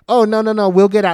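high, loud hurried appeasing; hammed-up high placating voice; imitated flustered partner. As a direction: mimic someone rushing to avoid a scene. Voices Stacy hurriedly giving way.

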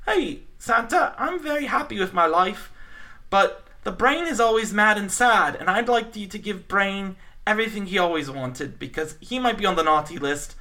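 light imitation of a sweet, simple mouse; Pinky. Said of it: "Pinky asks".

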